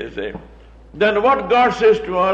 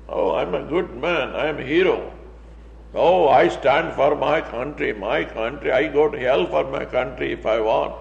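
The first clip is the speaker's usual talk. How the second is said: lower-pitched, self-satisfied hero talk; smug superiority; 6 semitones lower, 5 dB quieter than usual. Retold or imitated. Preacher mocks the angry man's smug pride, pitched low. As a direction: puff yourself up as a proud patriot. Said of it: "saying it with such a superiority".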